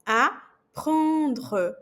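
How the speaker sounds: "Apprendre" com o R raspado na garganta; throaty French R. Shown as a demonstration. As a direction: puxe o R do fundo da garganta. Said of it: "um pequeno escarrinho". (R gutural francês)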